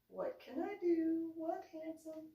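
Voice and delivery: lightly voiced high mom reply; a hint of doting mom, high-pitched; doting mother. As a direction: answer a little son in a high, lilting voice. Doting mother answers her son.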